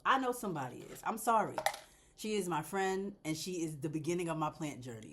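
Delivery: normal talking voice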